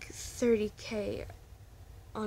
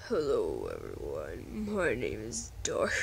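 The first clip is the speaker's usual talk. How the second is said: very low, put-on villain voice; low growling fan-character voice; deep Dark voice; 12 semitones lower than usual. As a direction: drop into a deep menacing character voice. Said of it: "I'm trying to get my voice deep enough".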